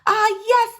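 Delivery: ordinary delivery, nothing marked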